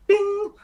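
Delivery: a high playful bing sound effect; ping it high like a magic chime; mock chime. Game master chiming as the bounty posters appear, high playful bing.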